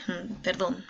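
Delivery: normal talking voice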